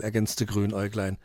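ordinary delivery, nothing marked

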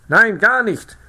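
high, loud, excited insisting; agitated town crier. A narrator's reading. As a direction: press the point frantically. (aufgeregter Ausrufer, hoch und laut)

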